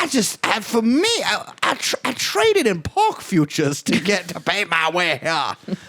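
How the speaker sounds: lightly put-on stump speech voice; mock Kamala speech voice. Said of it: "President Card voice".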